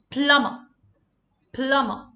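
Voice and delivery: Plumber said plamma, no b and no r; silent b plumber. Shown as a demonstration. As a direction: drop the b in Plumber. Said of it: "Letter B is silent here".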